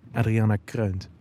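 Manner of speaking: plain reading voice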